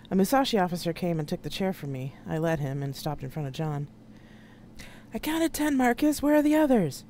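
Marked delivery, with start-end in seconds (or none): 5.1-7.0 high, strained-to-be-heard question; acted yell, pitched way up; yelling over noise. A narrator's reading. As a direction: shout to be heard over engines. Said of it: "he yelled over the noise of the ship".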